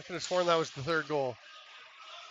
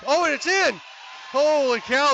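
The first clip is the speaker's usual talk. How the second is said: much higher and louder, shouting in amazement; excited goal shout; 8 semitones higher, 6 dB louder than usual. Real, not acted. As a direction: roar the goal. Goal call in live commentary.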